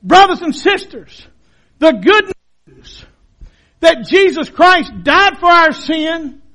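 ordinary talk, nothing marked

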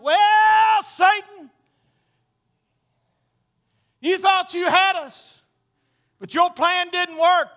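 high, loud, taunting proclamation; Michael. Acted. Archangel mocking Satan over his failed plan, loud taunt. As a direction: stretch the opening word, then crow over the foe. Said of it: "I don't know what Michael's gonna say".

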